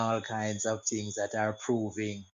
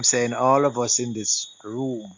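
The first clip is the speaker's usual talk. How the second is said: louder, projecting to the room; 8 dB louder than usual. Real, not acted. Panelist asked by host to speak up, louder. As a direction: push the voice out so all hear. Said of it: "Can you just project a bit for us".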